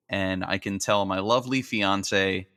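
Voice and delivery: ordinary talk, nothing marked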